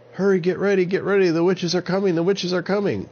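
urgent high shout; yelling. Acted. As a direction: shout the warning in a rush. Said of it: "he yelled".

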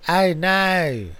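proud Australian "I know" with a hard I; Australian accent. Shown as a demonstration. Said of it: "That's better".

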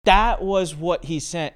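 ordinary talk

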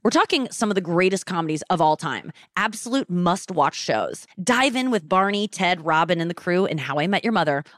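upbeat host-read streaming ad; podcast ad spot, read casually. Acted. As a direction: sell the show to listeners. Host reads a Hulu ad.

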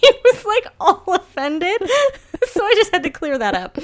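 words bubbling with laughter; laughing. Real, not acted. Wife retelling husband's offence, laughing while talking.

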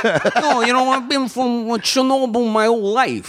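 a light Italian New Yorker voice; a touch of New York Italian. Acted. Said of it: "Italian, New Yorker?".

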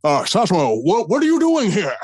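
lightly put-on Nixon, rising in mock surprise; a hint of a glad-handing politician. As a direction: Nixon pretending surprise at Louis, mildly imitated. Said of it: "And so Nixon's like".

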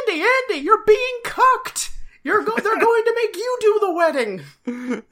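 high, loud panicked shouting; frantic yelling. Retold or imitated. Barney yelling a warning at Andy as he charges to the jail, high frantic shout. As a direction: yell the warning as you run in.

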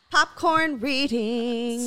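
slow, stretched-out "Popcorn reading"; playful slow announcement; drawn-out announcement. Acted. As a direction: announce "Popcorn reading" slowly like a game name.

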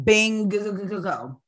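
"Bingo" stretched with a stuttered middle; drawn-out agreement. Real, not acted. Co-host agreeing about the co-pastor, stuttered drawn-out Bingo.

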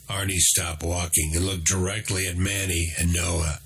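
plain reading voice

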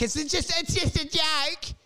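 high, pleading mock apology. Apologising to Nancy, high mock pleading voice. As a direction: plead sweetly to Nancy that it was a joke.

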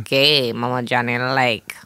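low, soft flirting voice; flirty purr. Acted. Mama Johnny flirts with the caller, low and purring. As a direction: purr it seductively down the line.

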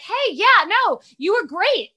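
high, loud fake enthusiasm; awkward gushing. Mom praising a boy she never saw play, awkwardly gushing. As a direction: overdo the praise, slowing on the compliment. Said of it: "that was awkward too".

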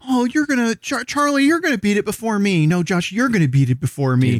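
high, gushing fake modesty; overly sweet, passing the compliment back; fawning mock politeness. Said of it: "We're all gonna be like".

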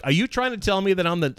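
high, quick disbelieving question; incredulous. Retold or imitated. Retells own disbelief at news.

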